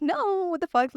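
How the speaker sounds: high, drawn-out "no"; retold protest. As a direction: jump up high in mock disbelief. Retells refusing friend's ambulance suggestion.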